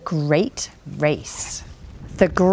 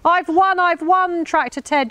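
high, excited winner's cry; gleeful high-pitched boast; crying out in triumph; 10 semitones higher than usual. A narrator's reading. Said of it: "she cried".

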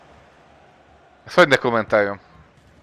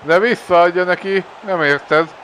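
excited; 6 semitones higher, 3 dB louder than usual. Real, not acted. Frustrated at teammate's pass.

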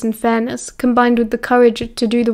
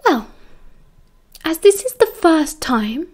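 slow, higher, reluctant giving-in; relenting; 5 semitones higher, slower than usual. A narrator's reading. Lily letting the couple off detention, relenting. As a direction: give way slowly, as if granting a favour. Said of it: "Lily relented".

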